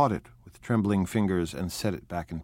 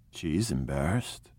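incredulous; 4 semitones lower, 3 dB quieter than usual. A narrator's reading. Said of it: "he repeated incredulously".

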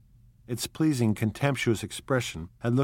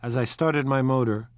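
ordinary delivery, nothing marked

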